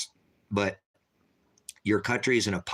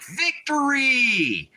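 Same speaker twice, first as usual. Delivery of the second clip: mock triumphant cheer, much higher, drawn out and slow; mocking victory cry; 5 dB louder, much slower than usual. Streamer mocks a chatter's gloating, hammed-up triumphant shout. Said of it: "He's like".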